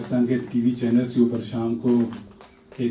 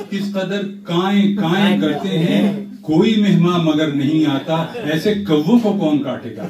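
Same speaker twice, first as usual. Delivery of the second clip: raised, lively comic recital; humorous nazm recitation; 5 semitones higher than usual. Acted. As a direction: deliver the punchline with flourish.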